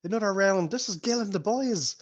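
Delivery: high barmaid calling across the bar. Acted. Barmaid calling to her husband for drinks. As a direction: shout the order to her husband.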